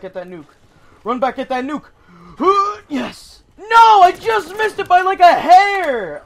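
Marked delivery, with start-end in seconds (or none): 2.1-2.8 sharp gasp. Real, not acted. Rushing to grab a power-up. 2.9-6.2 high, loud, frustrated outburst; excited yelling. Real, not acted. Just missed the nuke.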